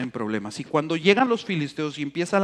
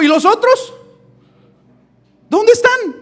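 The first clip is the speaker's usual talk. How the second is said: mock Jesus asking; 7 dB louder than usual. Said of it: "él dijo".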